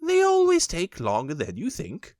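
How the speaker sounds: a hint of a brisk rabbit; mild rabbit voice; lightly voiced Rabbit. A narrator's reading. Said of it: "said Rabbit".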